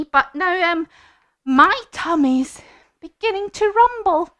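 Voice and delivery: high, loud, swaggering mouse; cocky little mouse. Acted. Mouse to the Gruffalo, confident mock threat. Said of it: "feeling very good at this point".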